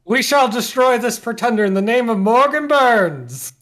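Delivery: high, loud proclamation; rousing battle cry. Acted. Morgan vowing to the kobold to destroy the pretender, battle cry. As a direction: declaim it like a hero rallying troops.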